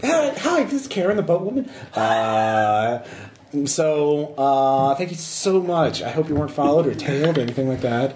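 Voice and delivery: bright bubbly greeting, gushing thanks. Acted. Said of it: "bubbly voice is like".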